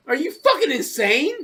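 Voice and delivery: ordinary delivery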